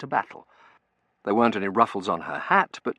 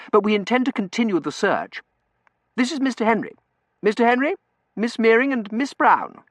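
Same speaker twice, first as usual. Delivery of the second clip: high, loud, hearty introductions; emphatic, raised gentlemanly voice; earnest young gentleman; 8 dB louder than usual. A narrator's reading. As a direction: introduce everyone with eager formality.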